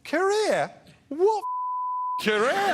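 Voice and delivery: high-pitched mock outrage; scornful disbelief. Imitating [name] mocking the comedian's career, scornful.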